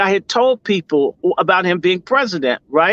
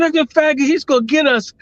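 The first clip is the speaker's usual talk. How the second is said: retold screaming; 9 semitones higher than usual. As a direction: yell it out as the memory comes back, much higher. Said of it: "I started screaming".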